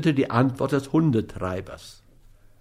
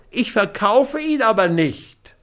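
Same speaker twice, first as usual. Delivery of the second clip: high-voiced, protesting refusal; whiny dog owner; 9 semitones higher than usual. A narrator's reading. Schmidt refuses to sell Scott the dog, whiny.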